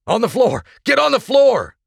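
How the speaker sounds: frantic high, loud order; urgent full-voiced shout; yell. A narrator's reading. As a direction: yell for everyone to drop, building on the repeat. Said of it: "the co-pilot yelled".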